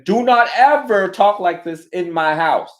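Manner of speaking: put-on strict parent scolding kids at the sink, loud; stern parent scolding. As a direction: lay down the law like a strict parent. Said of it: "turn around and say".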